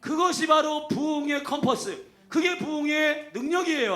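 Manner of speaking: slow, high, loud preaching shout; preaching at full force. Real, not acted. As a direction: proclaim it like a revival call. Preaching climax. (천천히 높고 크게 외치는 설교)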